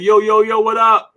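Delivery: a president hailing the crowd street style; playful hammed-up street hello from the podium; mock president greeting. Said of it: "came up on the podium and said".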